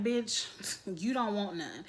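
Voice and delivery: mock-calm "you don't want none" at the boss; very calmly. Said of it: "Very calmly".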